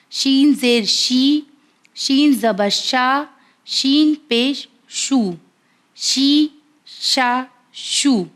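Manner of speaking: شین زیر شی drawn out slowly; slow letter drill. Shown as a demonstration. Teacher drills viewers on شین, slow and deliberate. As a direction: spell each, then drag شی شا شو out very slowly. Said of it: "اس کا sound کس طرح ادا ہوگا".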